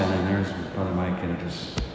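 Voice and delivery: ordinary delivery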